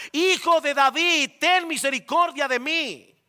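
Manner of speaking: high, loud, slow call. Acted. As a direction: cry out over the crowd. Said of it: "comenzó a gritar mucho más".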